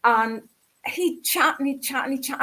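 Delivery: ordinary talk, nothing marked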